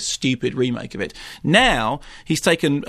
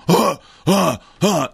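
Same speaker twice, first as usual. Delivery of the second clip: heavy huffed battle grunts; Viking horde grunts; 4 semitones higher, 4 dB louder, slower than usual. Retold or imitated. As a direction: mimic a charging horde with breathy huffs. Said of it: "who rush around going".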